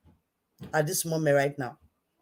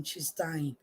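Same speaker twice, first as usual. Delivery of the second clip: hushed repeat; 10 dB quieter than usual. Shown as a demonstration.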